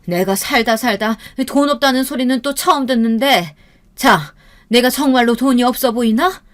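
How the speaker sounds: indignant, high-pitched comeback; offended, forceful acted retort; angry mother. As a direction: put an insolent son-in-law in his place. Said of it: "어머니가 화가 나서".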